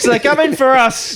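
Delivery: high, loud-edged imitation of panic; mock panicked veteran. Friend echoing the veteran about robots, mock panic. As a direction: mimic the veteran's high wail. Echoes the tearful veteran's line.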